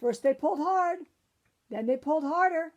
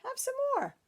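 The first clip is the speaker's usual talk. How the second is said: high offering voice; 12 semitones higher than usual. A narrator's reading. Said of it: "said the witch".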